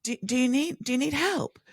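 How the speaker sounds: lightly acted anxious offer; mock worried. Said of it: "They were so worried about me".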